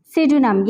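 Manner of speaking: pseudonym said "sudonim", no p; silent p in pseudonym. Shown as a demonstration. Teacher modelling pseudonym with silent p. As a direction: start pseudonym on the s. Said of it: "यहाँ भी पी हमारे पास silent है".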